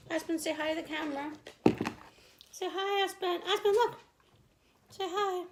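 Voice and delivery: sweet high pet voice; high dog-talk voice. Real, not acted. Talking to her dog.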